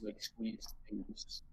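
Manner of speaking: unvoiced whisper, slow. Real, not acted. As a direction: breathe it back as a whisper. Friend echoes the explanation.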